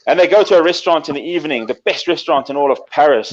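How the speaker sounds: ordinary talk, nothing marked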